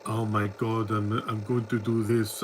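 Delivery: mock deadpan. Film fan mocking a Thunderbolts actress, deadpan imitation. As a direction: imitate lifeless, deadpan acting. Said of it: "they call deadpan acting good".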